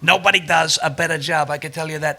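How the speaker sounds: low, boastful impression; Donald Trump. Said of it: "You do this Trump voice".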